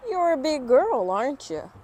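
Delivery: high, loud, prying remark; nosy grown-up. Acted. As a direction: boom it out like a tactless adult. Stranger remarks on her size.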